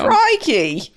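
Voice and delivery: high, hammy Aussie "Crikey"; Australian accent. Pretend. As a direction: do a cartoon Australian. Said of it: "I can't do an Australian accent".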